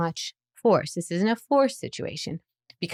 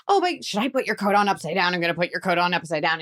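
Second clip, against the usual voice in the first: put-on silly, teasing offer to a child; playful teasing; faster than usual. Shown as a demonstration. Parent joking with a child about an upside-down coat, playful. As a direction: make it a goofy game. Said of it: "they're laughing".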